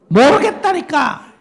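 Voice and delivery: high, loud, slow mocking "I don't know"; fed-up refusal. Preacher mimics a spouse refusing, high, loud and drawn out. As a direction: act out a stubborn spouse brushing it off. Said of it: "하나가 뭐라고?".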